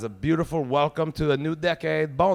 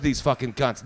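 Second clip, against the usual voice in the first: ordinary delivery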